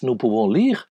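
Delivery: ordinary talk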